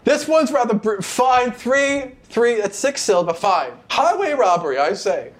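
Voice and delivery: put-on high, grumbling old woman; huffy old lady. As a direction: grumble about being robbed. GM voices grumbling melon woman.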